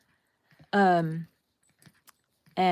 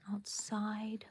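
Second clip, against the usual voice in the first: whisper; 15 dB quieter than usual. Acted. Trailing aside.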